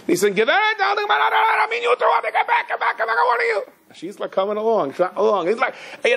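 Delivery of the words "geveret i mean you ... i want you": very high, strained mock shout; imitated screaming. Cop ordering old lady back from the line, imitated screaming. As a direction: frantically order someone back, acted way up high. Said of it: "he's screaming".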